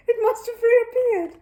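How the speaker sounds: high, loud and slow bear voice; small bear voice. Acted. Paddington explaining the egg to Mister Curry, innocently.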